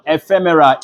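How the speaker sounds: ordinary talk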